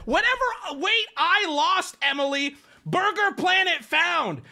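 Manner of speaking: high-pitched, incredulous yelling; exasperated disbelief. Real, not acted. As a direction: yell it high like you can't believe it. Disbelief at chat comparison.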